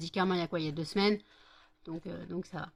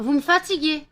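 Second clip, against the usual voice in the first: higher, louder fed-up cry; exasperated outburst; 7 semitones higher, 9 dB louder than usual. Real, not acted. Scene: owner fed up with jumping dogs, loud and higher. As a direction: let the exasperation burst out.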